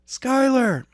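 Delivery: put-on high, loud cry of a girl's name. A narrator's reading. Girl on the phone crying out his name, high and loud. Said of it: "said a girl's voice".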